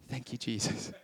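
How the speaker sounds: low, soft thank-you; hushed, acted prayer despite discomfort; quiet thanks. Preacher models thanking Jesus for a sore throat, quietly accepting.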